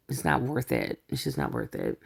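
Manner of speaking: low, soft and earnest; quiet sincere aside. Real, not acted. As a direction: drop your voice, it's just not worth it. Heartfelt aside about children in divorce.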